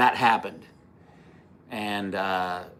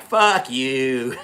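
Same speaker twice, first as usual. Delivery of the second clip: retold yell; 3 semitones higher, 5 dB louder than usual. Retold road-rage shouting match.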